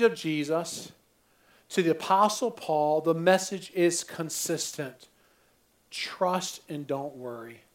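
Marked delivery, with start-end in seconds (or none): 5.9-7.6 low, slow and soft, letting each word sink in; slow soft emphasis. Acted. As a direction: lay it down gently and very slowly. Repeats the key message twice.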